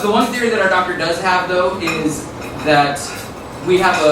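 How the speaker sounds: ordinary talk, nothing marked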